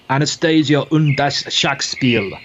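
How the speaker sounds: slow, careful German accent on "Anastasia und das Schachspiel". Shown as a demonstration. Chess teacher reading the German book title in a German accent. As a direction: give the title your best German accent, a touch hammed-up. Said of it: "in my best German accent there".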